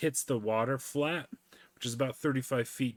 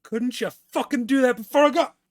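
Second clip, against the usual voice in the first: angry shout; 13 semitones higher, 10 dB louder than usual. Retold or imitated. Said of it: "and he's like".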